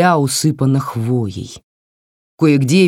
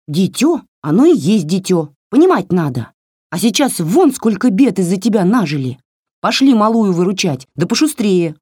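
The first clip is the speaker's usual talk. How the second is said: higher, snappy little doll telling her off; scolding doll voice; 5 semitones higher, 3 dB louder than usual. Acted. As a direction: scold like a cheeky tiny doll. Doll lectures. (высокий бранчливый голосок куклы)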